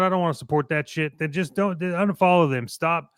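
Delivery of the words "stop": normal talking voice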